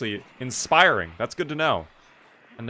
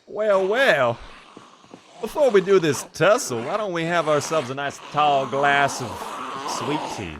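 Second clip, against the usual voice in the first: Southern accent; 3 semitones higher than usual. Shown as a demonstration. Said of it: "What is my southern accent?".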